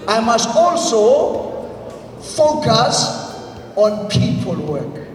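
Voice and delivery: slow, deliberate phrases for echo; spaced out so the crowd repeats; slow call and response. Shown as a demonstration. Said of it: "repeat after me".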